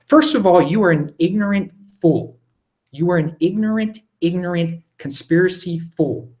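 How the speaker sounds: heated, stressed insult, slowing at the end; angry rebuke. Real, not acted. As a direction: tell off the questioner, emphatically. Rebukes a conspiracy-minded questioner.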